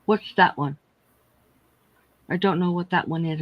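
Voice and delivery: ordinary talk, nothing marked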